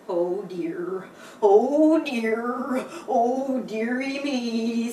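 mildly worried fretting. Acted. Horse mildly fretting over the tumbled animals. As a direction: fret gently over the fallen riders. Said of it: "says worried Mister Horse".

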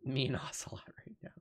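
soft unvoiced whisper. Real, not acted. Whispering a joke to chat. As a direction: whisper it like a secret.

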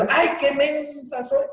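high, slow, put-on wail of self-pity; self-scolding whine. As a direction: squeal it like a silly woman who just pinched herself. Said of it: "Se prensa".

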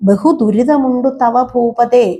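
slow, drawn-out chant; teacher intoning a classical line; slow verse recitation. Shown as a demonstration. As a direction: savour each syllable of the verse.

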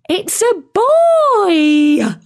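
high, drawn-out gleeful cry ending in a gasp; laughing. A narrator's reading. Penguin greeting the newly hatched chick, laughing. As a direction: crow it slowly with laughing delight. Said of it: "laughed Jen".

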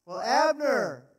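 loud, high, mocking taunt; David mocking Abner across the camp. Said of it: "David taunted".

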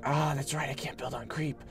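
whispered aside. Real, not acted. Muttering to self mid-game.

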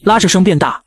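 plain reading voice

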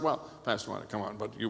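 low, soft voice of an objector; objecting churchgoer. Retold or imitated. Church member objecting to the pastor, imitated low and soft. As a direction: push back quietly, imitating a doubter.

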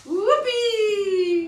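high, loud delighted whoop; whoopee. Acted. Wife scooped up by her new husband, loud high whoop. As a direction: whoop with glee as you're swept off your feet. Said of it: "as he scoops her up".

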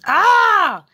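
loud high yelp of waking up; startled waking cry. Acted. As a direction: jolt awake with a loud cry. Creature wakes with a start.

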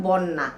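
put-on "বন্যা", a child's slurring of বড় মা; childhood mispronunciation. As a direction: say "বন্যা" with the middle syllable swallowed into a double n. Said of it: "ছোটবেলায় উচ্চারণ করতে পারতাম না বলে". (ছোটবেলার ভুল উচ্চারণে "বন্যা")

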